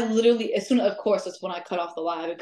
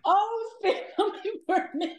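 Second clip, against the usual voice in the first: laughing mock fright; 11 semitones higher, slower than usual. Joking it targets her red hair.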